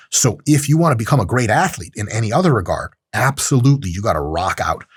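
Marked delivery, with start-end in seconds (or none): none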